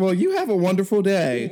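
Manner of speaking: sing-song the closing line like a scripted agent; chirpy customer service voice. Retold or imitated.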